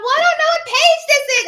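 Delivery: high, loud flustered wail; hammed-up frantic whine; mock panic. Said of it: "She was all panicking".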